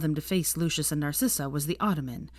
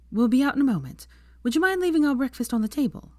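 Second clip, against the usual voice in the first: much higher, politely composed; bright young woman; 8 semitones higher, 3 dB louder, faster than usual. A narrator's reading. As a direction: keep it light and polite. Hermione voiced.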